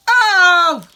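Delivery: sharp high cry of dismay as the beads spill; a sudden loud yelp of surprise; startled cry. Real, not acted. She spills her beads.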